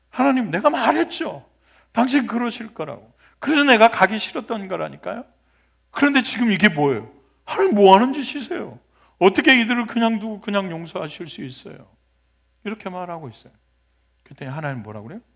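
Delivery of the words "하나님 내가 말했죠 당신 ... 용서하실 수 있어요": higher and louder, heated indignation; angry protest. Acted. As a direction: rail at God like a wronged prophet. Jonah protesting to God.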